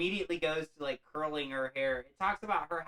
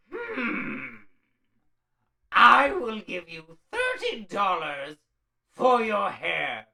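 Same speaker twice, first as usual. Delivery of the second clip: much higher, louder, slow put-on old shopkeeper voice; shady hair buyer; 5 semitones higher, 9 dB louder, much slower than usual. Hair buyer sizing up Della's hair, in a slow, high put-on voice. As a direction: drag out the offer like a sly merchant.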